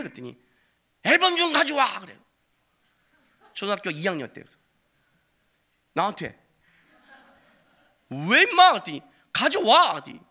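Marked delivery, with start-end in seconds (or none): 1.0-2.0 put-on bossy kid's shout, high and loud; demanding yell. Son demanding album. 8.1-8.7 sharp, high, loud comeback; father yelling back at his son; loud retort. Retold or imitated. 9.3-9.9 put-on bossy kid's shout, high and loud; demanding yell. Son demanding album.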